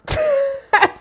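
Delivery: helpless laugh; real giggle fit; high squealing giggle. Real, not acted. Giggle fit during reading.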